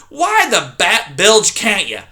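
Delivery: put-on loud, grumbling old porcupine; loud cranky old porcupine; grumpy old porcupine. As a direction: snap at the intruder like a cranky old coot. Erith voiced in story.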